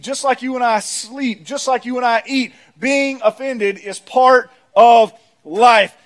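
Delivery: measured, insistent pulpit cadence; emphatic preaching. Acted. Preacher telling church offense is part of life, emphatic. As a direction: land each parallel phrase slowly, building in volume.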